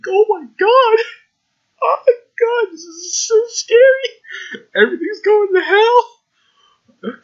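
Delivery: fake-sob the panic, dragging the words out; mock terror. Hammed-up terror at creepy scene.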